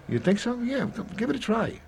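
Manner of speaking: high, eager voice lifting with hope; a patient suddenly keen to try; hopeful patient. Retold or imitated. Patient cheered up by the nurse, answering in a high eager voice.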